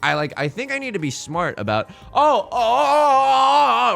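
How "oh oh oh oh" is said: excited. Real, not acted. Sudden tile discard excitement.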